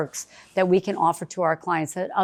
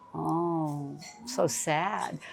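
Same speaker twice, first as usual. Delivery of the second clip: gasp then sad; 2 semitones lower, 10 dB quieter, much slower than usual. Real, not acted. Learns hotel was sold.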